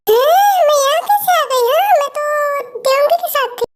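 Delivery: high, bewildered questioning; waking up dazed, asking where she is; confused waking up. Acted. Girl coming to, confused and high.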